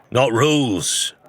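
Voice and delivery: plain reading voice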